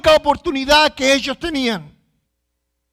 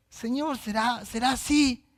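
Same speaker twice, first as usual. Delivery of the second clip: small, very soft, doubtful voice; imitated weak prayer; 9 dB quieter than usual. Believer praying without conviction, feeble and hesitant. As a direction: barely voice a timid prayer. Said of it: "nuestras oraciones son débiles".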